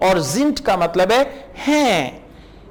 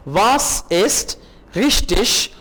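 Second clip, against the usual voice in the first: slow model reading; much slower than usual. Shown as a demonstration. Slow German model phrase.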